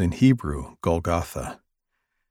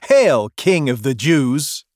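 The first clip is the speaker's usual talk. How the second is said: higher, louder, slow mock homage; slow jeering hail, louder and higher; mocking soldiers; 6 semitones higher, 6 dB louder, slower than usual. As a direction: sneer the salute as a jeer.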